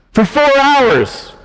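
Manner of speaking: for four hours stretched high and slow; drawn out. Real, not acted. Pastor stressing how long the talk lasted, drawn out.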